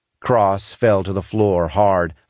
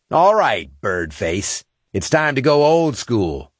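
cocky taunting shout; 5 semitones higher than usual. A narrator's reading. Cross taunts before charging.

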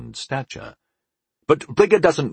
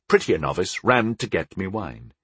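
plain reading voice

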